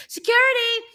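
loud, high call for help. Acted. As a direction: shout it high to summon a guard. Calls out for security.

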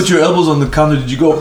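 ordinary talk

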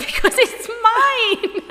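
high, loud, drawn-out laughing retort; exclaimed protest. Retold or imitated. Retelling a comeback to a friend about the accent, laughing exclamation. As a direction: protest loudly through laughter.

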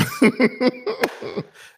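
high burst of laughter; bright laugh. Real, not acted. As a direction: laugh out after the intro. Laugh after intro.

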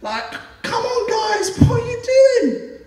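high, slow, rising to a shout; high exasperated voice building to a shout; exasperated Jesus. Retold or imitated. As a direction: call out in disbelief at what they're doing. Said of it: "thinking to himself".

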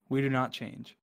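usual speaking voice

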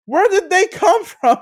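high, laughing disbelief; laughing shocked question. Retold or imitated. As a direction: squeak it out in shock.

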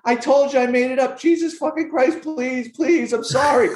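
high, panicky mock begging; pleading guy. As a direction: squeal it out like a terrified snitch. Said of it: "And the guy's like".

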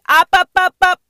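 high, loud calling out. Acted. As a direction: summon them upward. God calling man up.

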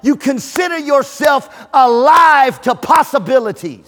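loud, drawn-out sermon shout; booming preacher's call; preaching at full force. Acted. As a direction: preach it out big to the crowd.

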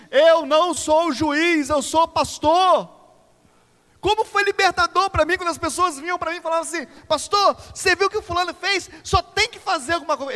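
loud, high fiery preaching. Acted. Pastor preaching hard to the congregation, fiery. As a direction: drive the message home to the church.

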